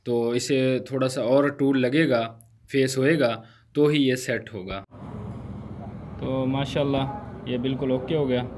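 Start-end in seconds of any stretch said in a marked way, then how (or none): none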